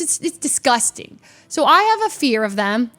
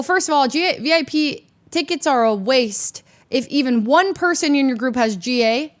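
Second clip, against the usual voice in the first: ordinary talk, nothing marked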